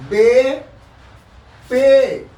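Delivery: "बे" and "पे" called out loud and clear; letter names recited. Shown as a demonstration. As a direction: recite the Urdu letters "बे", "पे" firmly to the class. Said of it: "इसको पढ़ते हैं उर्दू में पे". (अक्षरों के नाम ज़ोर से)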